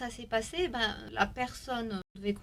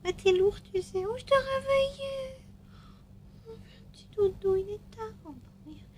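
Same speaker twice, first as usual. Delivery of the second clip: high cooing voice to a puppy; 11 semitones higher, 5 dB louder than usual. Real, not acted. Cooing to the dog.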